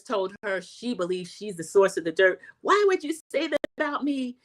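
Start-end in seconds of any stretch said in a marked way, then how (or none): 2.6-4.4 imitating a tearless cry, pitched up; whiny fake-hurt plea; mock crying whine. Wife mimics Lisa's tearless fake cry, whining. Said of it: "She's got her cry face on with no tears".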